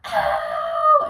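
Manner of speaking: loud drawn-out cry of "ow"; imitated pained yell; re-enacted scream. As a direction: scream out in pain, acting it out. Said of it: "And I, like, screamed".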